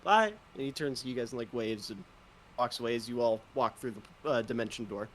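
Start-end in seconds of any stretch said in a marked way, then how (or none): 0.0-0.3 higher, bright little farewell; cheerful little bye. Acted. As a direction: chirp it and wave. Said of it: "And Bingley just goes".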